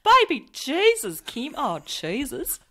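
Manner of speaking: high, drawn-out Australian sitcom voice; Kath and Kim. Acted. Quoting a sitcom moment to a co-host, exaggerated Australian accent. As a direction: stretch the Australian vowels way up high. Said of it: "Do you Kath and Kim it?".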